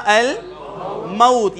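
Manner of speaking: very slow al-mawt, diphthong aw; الموت with fatha on meem. Shown as a demonstration. Teacher demonstrates Quranic form, very slow. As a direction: stretch الموت as al-ma-ut. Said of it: "یہاں پہ واو لین ہوگا".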